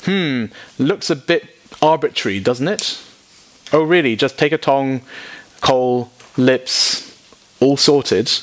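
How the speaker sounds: mock the idea as too easy; sceptical reader. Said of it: "everyone reading that thinks".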